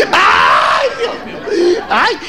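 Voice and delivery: hammed-up cry of grief, shouted; theatrical shouted lament; mock mourning wail. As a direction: wail like a widow losing her soul. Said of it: "lloran que sienten que el espíritu".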